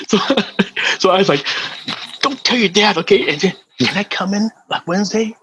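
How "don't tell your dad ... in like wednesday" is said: put-on conspiring young son. Son secretly asking to join.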